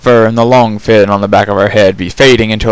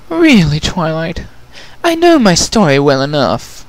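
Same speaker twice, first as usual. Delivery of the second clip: a touch dry and refined, higher; Rarity, mild eye-roll; 7 semitones higher than usual. Acted. Rarity answers Twilight, lightly exasperated. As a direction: roll it off drily.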